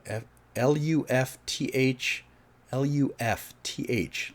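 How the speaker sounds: L U F T H spelled slowly letter by letter; spelling out letters. Shown as a demonstration. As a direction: spell out L U F T H slowly to get it right. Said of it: "it's a hard word for me".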